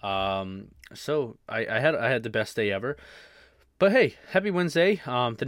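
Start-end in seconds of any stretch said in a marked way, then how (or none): 3.8-5.0 high, loud happy Wednesday; cheerful greeting. Acted. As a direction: sing out a cheery hello.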